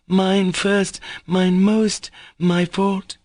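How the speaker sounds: high, tearful wail of guilt; heart-rending cry. A narrator's reading. Grushenka at the captain's feet, wailing high. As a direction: plead through tears. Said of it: "she cried in a heart-rending voice".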